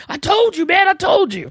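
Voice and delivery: put-on high, gloating kid voice; excited kid. Nephew gloating at his uncle after the movie, high and excited. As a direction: crow it like a nine-year-old who called it.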